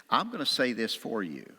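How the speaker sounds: ordinary talk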